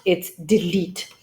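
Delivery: delete said dih-LEET, ending like eat; correct delete. Shown as a demonstration. Teacher models the correct delete for students. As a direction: slowly give delete with a long ee. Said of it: "Well, it's not".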